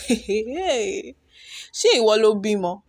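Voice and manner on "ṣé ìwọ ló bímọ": indignant. Real, not acted. Indignant retort in Yoruba.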